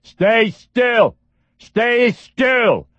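high nasal scream. A narrator's reading. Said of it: "a loud nasal voice was screaming".

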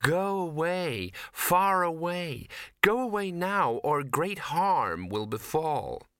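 a dreamy spell-bound warning, drawn out; sweetly eerie. Acted. Ginger entranced, sending Victor away with an eerie sweetness. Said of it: "she said sweetly".

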